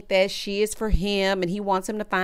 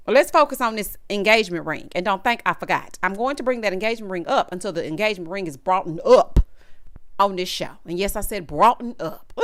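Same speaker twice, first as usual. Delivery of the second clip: ordinary delivery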